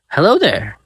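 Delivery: high little creature voice; chirpy small monster greeting. A narrator's reading.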